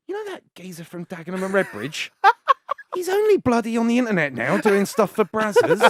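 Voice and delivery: high, loud, excited gossip; breathless scandal to a friend; mock gossiping wife. Imagined wife gossiping loudly.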